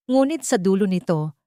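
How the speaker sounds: ordinary delivery, nothing marked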